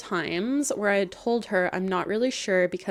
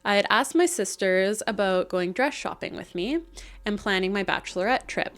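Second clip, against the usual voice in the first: usual speaking voice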